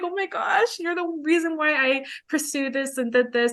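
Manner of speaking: high-pitched, mildly emotional retelling; an overcome "oh my gosh", retold without tears; retold tearful gush. Student thanking the counselor who swore them in, retold tearful gush. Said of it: "I remember just crying".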